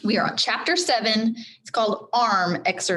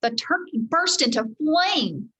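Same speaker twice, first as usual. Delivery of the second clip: ordinary delivery, nothing marked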